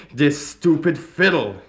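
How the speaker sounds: loud, slow furious outburst; angry cry. A narrator's reading. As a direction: shout it at the fiddle in frustration. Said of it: "he cried".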